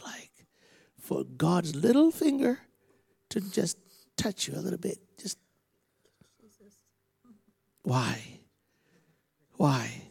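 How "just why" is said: usual speaking voice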